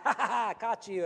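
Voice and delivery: loud, high hammed-up "ha ha ha"; playful mock triumph, laughing; mock gotcha laugh. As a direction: pounce with a gloating laugh. Mocking someone caught in the act.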